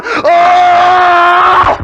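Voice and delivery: loud high groan of a flattened player; pained shout. Retold or imitated. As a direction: let out a loud hit "Oh!". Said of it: "and this guy's going".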